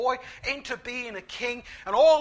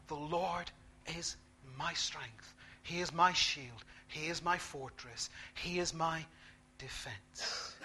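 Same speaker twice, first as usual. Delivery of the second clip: much softer and lower, ending in a whisper; quiet confession; 7 semitones lower, 13 dB quieter than usual. Shown as a demonstration. Preacher models a whispered confession in desperate moments. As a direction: murmur it to yourself as a private vow.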